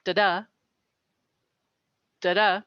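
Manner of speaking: da-da with the second da stressed; iamb rhythm. Shown as a demonstration. Teaching an iamb, stress on the second da. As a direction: tap out the iamb, weight on the second beat. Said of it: "the accent's on the second one".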